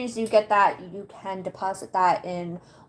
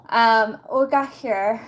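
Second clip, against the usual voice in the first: ordinary talk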